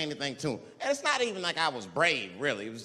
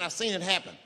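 ordinary delivery

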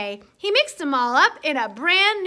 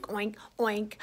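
plain reading voice

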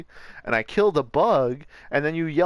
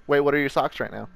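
ordinary talk, nothing marked

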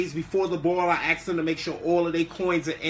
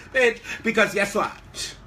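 normal talking voice

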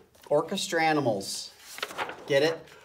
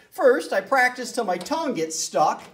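whiny anteater; 12 semitones higher than usual. A narrator's reading. Anteater complains.

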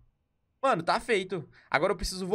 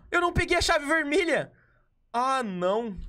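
sudden high cry of dismay, much higher and louder; shocked dismay; 9 semitones higher, 4 dB louder than usual. Real, not acted. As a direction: yelp in frustrated disbelief. Forgot the red key. (espanto indignado)

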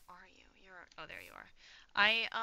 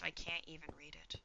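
whispering; 2 semitones lower, 8 dB quieter than usual. Real, not acted. Muttered complaint about unreadable text.

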